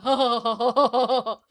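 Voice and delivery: loud over-the-top ha-ha; loud hammed-up ha ha laugh; loud fake laugh. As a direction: guffaw too loudly on purpose. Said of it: "I don't wanna be like".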